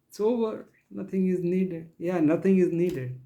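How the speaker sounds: lightly voiced teacher waving it all away; imitating false teachers. Preacher voices those who teach that grace is enough, mock assurance.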